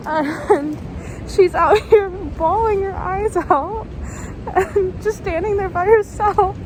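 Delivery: real teary sobbing telling; crying. Real, not acted. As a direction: tell it while really crying. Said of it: "I don't know how to stop".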